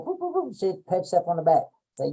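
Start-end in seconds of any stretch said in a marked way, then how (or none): 0.1-0.6 silly boops. Shown as a demonstration. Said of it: "bend over and go".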